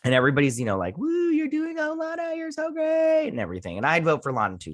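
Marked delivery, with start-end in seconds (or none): 1.0-3.3 very high squealed cheer; mock crowd cheering. Everybody cheering on Lana for mayor, high mock cheering. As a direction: imitate an adoring crowd in a high voice.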